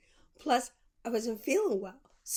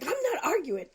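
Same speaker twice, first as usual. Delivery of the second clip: higher, louder indignant snap; defensive retort; 7 semitones higher, 6 dB louder than usual. Retold or imitated. The clerk snapping back at her, higher and louder. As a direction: fire it back, insisting you're not fighting.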